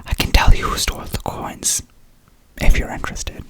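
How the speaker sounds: put-on reading the mystery in a light whispered British accent; a hint of a British detective, all in whisper; British accent read as an ASMR whisper. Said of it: "Sorry I broke the accent".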